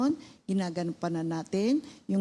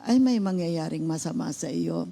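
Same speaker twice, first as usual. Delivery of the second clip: ordinary delivery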